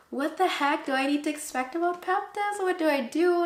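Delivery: high-pitched retold fretting; anxious questioning. Acting out anxious questions to sister.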